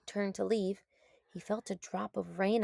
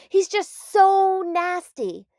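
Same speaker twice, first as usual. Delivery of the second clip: higher, louder, slow complaining; whiny lizard; 10 semitones higher, 9 dB louder, slower than usual. A narrator's reading. Lizard complaining about mean Cactus Jack, high and whiny. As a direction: drag out the complaint.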